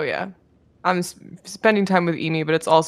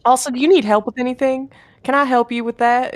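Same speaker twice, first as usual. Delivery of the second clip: high, bright friendly pleading; cheerful Aegon; 7 semitones higher, 4 dB louder than usual. Acted. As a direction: sweet-talk your way to the pet.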